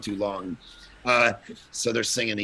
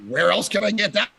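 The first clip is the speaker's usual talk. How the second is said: higher and louder, playful; excited joke; 9 semitones higher, 5 dB louder than usual. Real, not acted. Pastor joking to his podcast host, excited. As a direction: toss it out with delight. Joking about yelling preacher.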